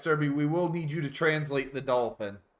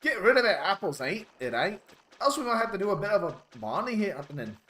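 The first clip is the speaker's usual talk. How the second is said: broad, hammed-up Aussie, higher pitched; insane Australian; 7 semitones higher than usual. Dolphin threatening over the lighthouse, over-the-top Australian. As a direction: grumble like a put-upon Australian. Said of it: "I went full British there".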